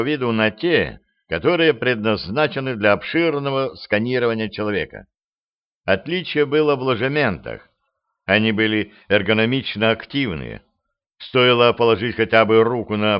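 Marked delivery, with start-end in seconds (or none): none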